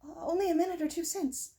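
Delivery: high, stammering, shaken answer; frightened stammer. A narrator's reading. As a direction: stumble over the words in fright.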